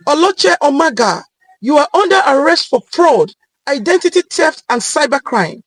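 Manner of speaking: loud, high official command; police arrest call. Acted. Police officer bursting in, shouting the arrest. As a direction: announce the arrest with force.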